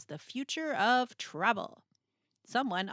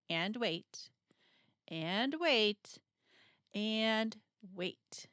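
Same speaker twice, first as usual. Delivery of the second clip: drawn-out waiting; much slower than usual. Acted. Waiting days for parts.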